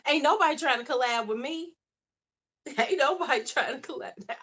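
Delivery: amused mock lament; laughing self-mock. Real, not acted. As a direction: joke at her own expense. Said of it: "joking self-pity with laugh".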